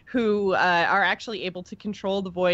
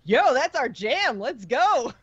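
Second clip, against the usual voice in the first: casual dude-voiced ethereals, higher and slow; ethereals' voice; 6 semitones higher, slower than usual. Host voicing ethereals drawn to the Void, casual hype. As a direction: lightly play the ethereals as hyped bros. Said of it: "the ethereals are just like".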